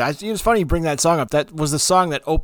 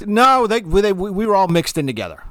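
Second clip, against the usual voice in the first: high, louder burst of protest; emphatic denial; 7 semitones higher, 3 dB louder than usual. Real, not acted. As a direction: jump in to set the record straight. Protesting the segregation question.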